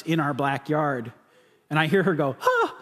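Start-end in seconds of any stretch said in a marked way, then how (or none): 2.4-2.8 sharp high startled yelp; startled scream. Retold or imitated. Wife startled by lightning in the backyard, high yelp. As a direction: cry out in shock at the flash.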